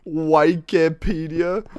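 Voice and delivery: "Wikipedia" as WIKE-uh-pee-dee-a; slow, incredulous "Wikipedia", long i first; mocked pronunciation. Streamer mocking a chatter's version, slow WIKE-uh-pee-dee-a. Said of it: "Coming into my chat saying".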